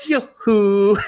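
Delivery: high, loud sing-out greeting; hammed-up teacher playing the teenager; mock teenager. Awkward teacher greeting his student, trying to sound like a teenager. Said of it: "talk like a teenager".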